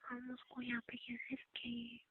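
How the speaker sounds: hushed, breathy secret whisper. Acted. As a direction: whisper so nobody outside hears. Hiding the sister secretly.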